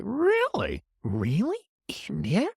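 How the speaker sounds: imitating a surprised listener, high first Really; hammed-up incredulous Really; mock disbelief. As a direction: play a doubtful listener asking Really? India?. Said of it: "one or two of you were like".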